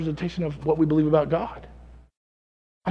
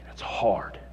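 1 hushed quote; 8 semitones lower, 9 dB quieter than usual. Retold or imitated. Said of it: "what did he say".

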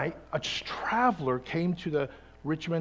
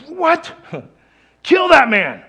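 higher, much louder indignant outburst, slowed on the last words; outraged shout; 10 semitones higher, 13 dB louder, slower than usual. Retold or imitated. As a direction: leap up furious and demand his death. David jumps up, outraged verdict.

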